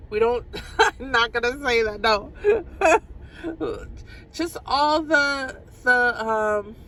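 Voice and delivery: can't hold back the laughter; breaking into real giggles mid-sentence; laughing. Real, not acted. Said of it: "I don't know why I'm laughing".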